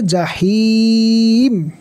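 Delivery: high, very slow recited জাহিম with a stop at the end; stopping form জাহিম, slow and chanted; pausal form. Shown as a demonstration. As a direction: draw out জাহিম and stop on the final consonant. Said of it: "আর যদি থামতে চান".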